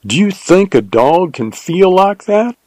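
incredulous, climbing at the end; dubious. A narrator's reading. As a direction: ask it in mild disbelief. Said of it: "ejaculated Jim dubiously".

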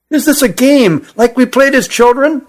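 high-pitched, doubting lad; incredulous question. A narrator's reading. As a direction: question it in disbelief, pitched well up. Cousin doubts the friend's story.

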